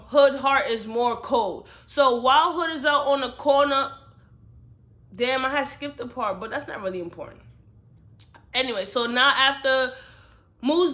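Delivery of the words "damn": ordinary talk, nothing marked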